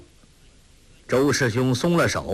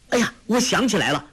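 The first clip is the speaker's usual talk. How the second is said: much higher and fast, sudden realisation; excited cry; 10 semitones higher, faster than usual. Acted. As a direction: blurt it out as it comes back to him. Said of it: "叫道".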